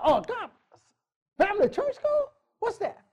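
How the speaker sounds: high, soft mimicking voice; mock clueless churchgoer. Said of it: "many of you ain't even thought about".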